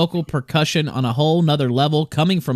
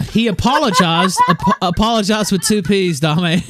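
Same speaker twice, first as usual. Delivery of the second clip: laughing; 7 semitones higher, 4 dB louder than usual. Real, not acted. Laughing at typo.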